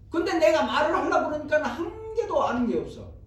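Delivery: high, loud mock complaint; exasperated churchgoer. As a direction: wail in protest, drawing words out. Acts tongue-tied churchgoer's complaint. (높고 크게 하소연하듯)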